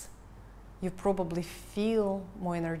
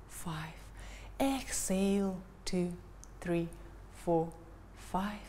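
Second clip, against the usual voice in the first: normal talking voice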